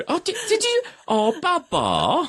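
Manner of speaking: very high, slow cooing; baby talk. Pretend. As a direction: coo like talking to a baby. Cooing over the empty drink.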